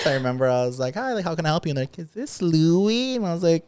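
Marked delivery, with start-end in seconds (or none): 0.9-1.7 bright, higher phone-greeting voice for a caller; mock sweet service voice, pitched up; customer service voice. Answering a work call in a mock service voice. 2.0-3.2 slow, higher put-on voice of a caller; imitating a caller. Caller on the work phone, slow and put-on.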